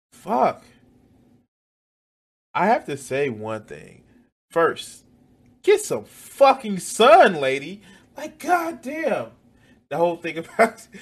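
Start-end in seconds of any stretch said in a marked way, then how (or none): 5.6-9.3 high, loud, slow yell; exasperated yell. Real, not acted. Yelling at the woman in the video, high and loud. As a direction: bellow the insult at the screen.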